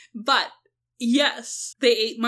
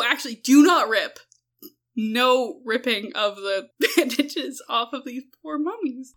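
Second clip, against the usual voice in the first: usual speaking voice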